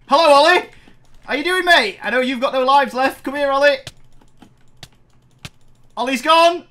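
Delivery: high, loud singsong taunting; mocking taunt. Real, not acted. Taunting doomed rival Ollie in-game, loud mocking singsong. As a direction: rub it in, loud and gleeful.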